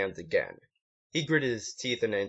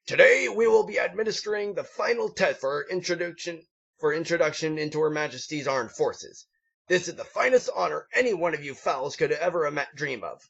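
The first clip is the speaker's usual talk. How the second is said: higher, pompous drill sergeant voice; gruff drill instructor; 7 semitones higher than usual. A narrator's reading. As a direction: address the recruits like a proud sergeant. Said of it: "The gruff, olive green pony snorted".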